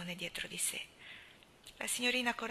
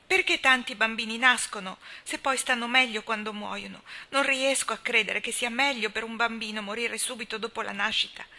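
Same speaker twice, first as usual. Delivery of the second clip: loud, urgent questioning; impassioned; 2 semitones higher, 7 dB louder than usual. A narrator's reading. Anna demanding answers from Marilla, loud and impassioned. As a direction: fling the questions out. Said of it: "aggiunse con impeto".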